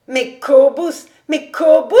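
high, loud, imploring cry; piercing, pathetic. A narrator's reading. David begs Cobus for the loan, piercing and pathetic. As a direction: plead piercingly, stretching the name. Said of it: "d'une voix perçante et pathétique".

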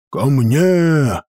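barked dwarf command; gruff call to come close; gruff gravelly dwarf voice. A narrator's reading. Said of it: "рявкнул гном".

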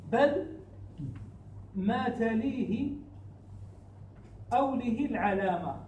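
slow, drawn-out chanted verse; verse recitation. Shown as a demonstration. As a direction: recite each word of the line slowly. Teacher recites verse again.

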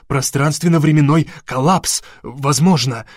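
ordinary delivery

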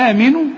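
آمنوا opening on a long "aa"; full alif. Shown as a demonstration. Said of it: "بتديك ألف كاملة". (مد الألف في آمنوا)

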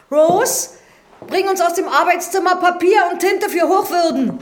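calling out. Acted. Housekeeper calls her niece in the study loudly. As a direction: call her loudly from the other room.

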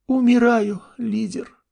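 high, mock-dying whimper; dying girl. A narrator's reading. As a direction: play it as a hammy death scene. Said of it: "imp dying in clan chat".

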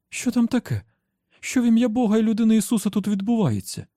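high, startled cry of alarm; high alarmed woman's voice; alarmed Rosalita. Acted. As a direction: burst in frightened and shrill-high.

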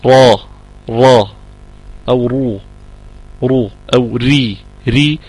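را then رو then ري, the ra kept at one fixed place; ra with each vowel. Shown as a demonstration. Teacher shows the ra with fatha, damma and kasra, slow demo. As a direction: sound each vowel on the ra slowly. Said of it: "سواء أنا قلت".

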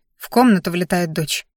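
plain reading voice

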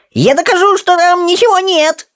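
extremely high squeaky protest; squealing. Acted. Rolla insists the grotto is empty, very high squeal. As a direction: squeal your innocence. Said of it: "завизжал он".

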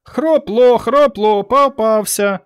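very high, loud taunting chant; high singsong mockery; children's gleeful jeering. A narrator's reading. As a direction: jeer like a pack of joyful kids. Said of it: "загаласували радісно".

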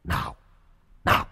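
begging ghost. Acted.

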